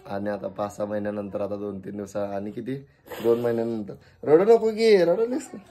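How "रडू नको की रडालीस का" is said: much higher, coaxing singsong to a crying mum. Real, not acted. Son coaxes his crying mother to stop, high playful coaxing.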